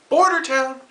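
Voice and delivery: Border town, high and punched; emphatic repeat. Acted.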